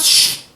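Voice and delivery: sharp shush; hissed shush. Acted. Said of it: "Suaranya keras".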